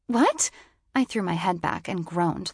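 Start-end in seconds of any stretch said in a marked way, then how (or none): 0.1-0.5 shocked. A narrator's reading. Hearing bus has left.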